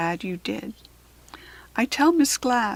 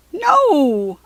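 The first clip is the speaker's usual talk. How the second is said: a loud, higher shouted refusal; 6 semitones higher, 6 dB louder than usual. Acted. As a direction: blurt out a loud, firm no. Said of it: "I shout".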